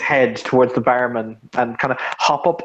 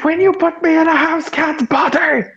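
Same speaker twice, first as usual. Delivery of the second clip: high-pitched shout. Acted. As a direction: yell it in outrage. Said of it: "the cat shouts that".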